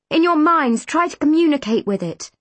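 forceful and emphatic; urgent instruction. A narrator's reading. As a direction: press the instruction on them. Urgent instructions facing the monster.

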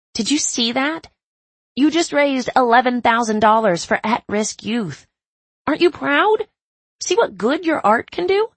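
high, bright excited praise; thrilled. Acted. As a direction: gush with pride at her. Caroline thrilled, higher and louder.

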